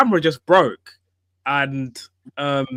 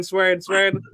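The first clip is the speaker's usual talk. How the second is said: normal talking voice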